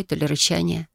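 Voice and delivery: ordinary delivery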